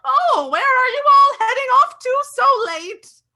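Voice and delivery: put-on high, loud sweet old neighbour calling out; old neighbour calls out to the group from her hedges, high and loud; friendly old lady. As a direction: call across the hedge like a kindly old lady. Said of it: "she gives you a friendly wave before calling out".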